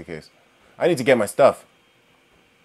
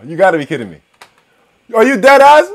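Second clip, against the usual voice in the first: normal talking voice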